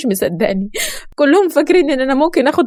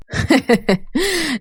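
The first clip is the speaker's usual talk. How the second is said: sly laugh; 5 semitones higher than usual. A narrator's reading. Man laughing at her question.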